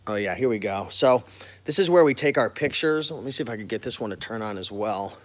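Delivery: usual speaking voice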